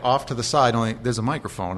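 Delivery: normal talking voice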